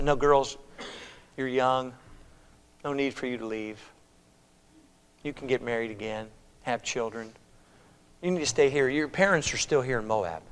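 soft, low, unhurried gentle voice; hushed, tender release with pauses; gentle urging. Acted. Naomi tells her daughters-in-law to stay in Moab, gently.

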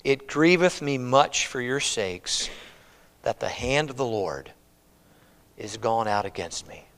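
low, soft, sorrowful reading; grieved confession. Acted. Naomi tells her daughters of God's hand against her, grieved. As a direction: sink into quiet grief, with heavy pauses. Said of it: "but not anymore".

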